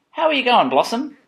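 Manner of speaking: high singsong mother; mother's question. Acted. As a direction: call out high to your little one. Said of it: "her mother would watch her and ask".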